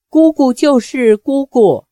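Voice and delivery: plain reading voice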